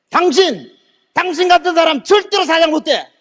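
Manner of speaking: high, forceful denunciation; angry parting shot. Retold or imitated. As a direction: tell them off hard, re-enacting the walkout. Presenter storms out with parting words.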